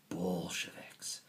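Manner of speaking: slow, hushed whisper of Bolsheviks; ominous whisper. Acted. Teacher warning the class of the Bolsheviks, whispered. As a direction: breathe Bolsheviks like a dark secret.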